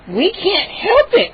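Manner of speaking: slow, high gnome voice. Acted. Gnome interrupting the boy, slow and high. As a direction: cut him off pleading. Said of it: "Hap interrupted".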